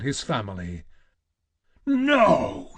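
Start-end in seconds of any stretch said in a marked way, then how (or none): 1.9-2.7 much higher, loud anguished cry; howl. Acted. Kratos dragged toward his family, anguished howl. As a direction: tear it out of him. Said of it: "he howled".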